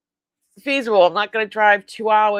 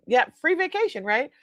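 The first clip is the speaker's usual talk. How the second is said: ordinary talk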